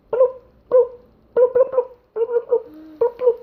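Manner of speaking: high pitched bubbly bloops; cartoon sound effect; portal bloop sounds. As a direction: make the portal close with little bloops.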